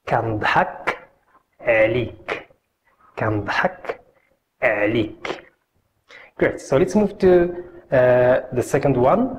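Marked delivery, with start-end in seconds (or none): 0.1-5.4 repeat-after-me demo. Shown as a demonstration. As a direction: lower voice, phrase in two pieces. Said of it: "Can you repeat it?".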